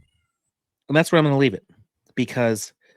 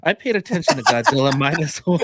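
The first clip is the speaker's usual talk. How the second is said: real laughter bubbling through the words; laughing while talking; breaking into laughter; 7 semitones higher, 3 dB louder than usual. Real, not acted. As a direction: start the sentence, then crack up high and helpless. Cracks up correcting his slip.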